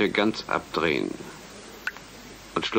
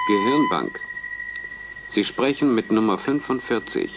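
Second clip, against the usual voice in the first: 1 detached businesslike; 4 semitones higher than usual. Shown as a demonstration. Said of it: "Schon besser, da darf keine Stimmung mitschwingen".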